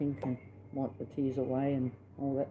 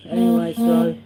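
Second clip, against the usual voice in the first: sudden loud burst; 10 semitones higher, 14 dB louder than usual. Real, not acted. Sudden loud restart of story.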